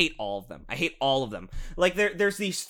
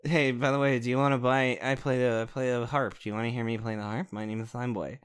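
put-on drunken slurred mumble; 3 semitones lower, faster than usual. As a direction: run the words together like a tipsy bad actor. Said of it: "and he's mumbling".

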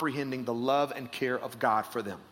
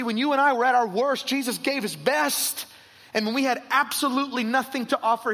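higher, louder swell; preaching climax; 7 semitones higher, 5 dB louder than usual. Acted. Pastor tells the church of Jesus' sacrifice, rising. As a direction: rise to the peak.

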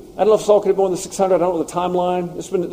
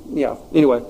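usual speaking voice

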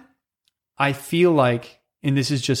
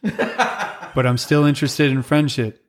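mock seller; 3 semitones lower than usual. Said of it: "He goes".

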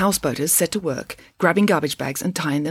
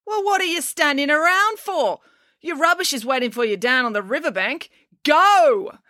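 calling out orders; 10 semitones higher than usual. A narrator's reading. Geraldine starts the students on the race, calling out. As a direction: send them racing, pitched well up.

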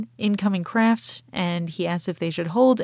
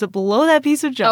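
raised, louder mock yell; Kylo screaming; 5 semitones higher, 6 dB louder than usual. Retelling Kylo's order to his fighters, mock screaming. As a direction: bark it like an enraged commander's order. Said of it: "and then Kylo screams".

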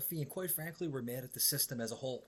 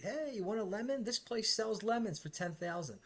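higher, mock street hawker; heckling passersby; 9 semitones higher than usual. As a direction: call out to the cars.

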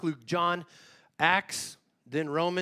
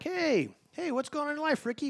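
high, bright mock friendly hello; chirpy friend checking in; cheery greeter; 10 semitones higher than usual. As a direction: hail Ricky like a cheerful churchgoer. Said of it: "it's like".

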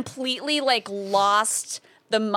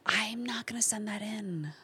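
hushed, imitated manager; whispered refusal; 11 dB quieter than usual. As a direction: whisper the bad news as the manager.

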